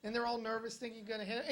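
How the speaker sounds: ordinary talk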